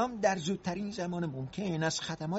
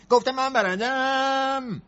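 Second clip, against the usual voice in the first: loud, slow and deliberate; louder repeat, every word stretched; 4 semitones higher, 7 dB louder, much slower than usual. Acted. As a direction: spell it out loudly for the one who missed it. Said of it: "نباید اینو بلند بگی".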